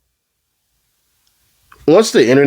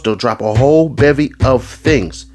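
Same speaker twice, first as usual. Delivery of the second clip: usual speaking voice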